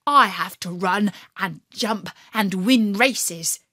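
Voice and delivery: ordinary delivery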